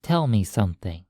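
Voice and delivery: usual speaking voice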